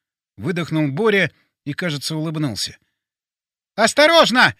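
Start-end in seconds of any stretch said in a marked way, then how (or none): 3.7-4.5 loud high warning shout. A narrator's reading. Colonel shouting a warning to the narrator. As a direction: yell a sudden warning. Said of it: "закричал полковник".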